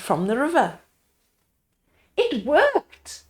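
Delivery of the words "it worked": higher, excited cry; a villager cheering that it worked; cried out. A narrator's reading. Villager crying out in delight. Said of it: "cried one of the villagers".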